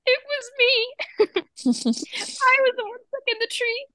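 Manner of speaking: put-on high, slow little girl admission; mock guilty kid; imitating a child owning up, high; D W confessing.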